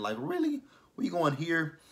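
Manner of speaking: a hammed-up, high "really?"; exasperated disbelief. [name] asked about charges, answers her with high mock disbelief.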